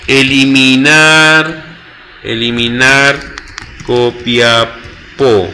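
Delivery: very slow, drawn-out syllables on a level low note; stretched, monotone typing-along voice; dictating while typing. Real, not acted. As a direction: spell it out slowly as you type. Dictating the query name while typing.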